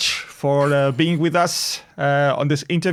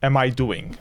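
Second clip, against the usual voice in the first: ordinary delivery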